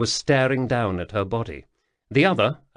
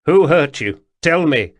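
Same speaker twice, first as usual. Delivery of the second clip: loud, sharp demand; 5 semitones higher, 8 dB louder than usual. A narrator's reading. Colin demanding answers from the ghost, loud and pressing. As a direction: press him hard for the truth.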